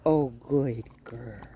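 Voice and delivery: slow, drawn-out praise; soothing praise. Real, not acted. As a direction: gently reassure the dog. Soothing the dog.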